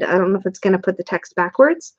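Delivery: ordinary talk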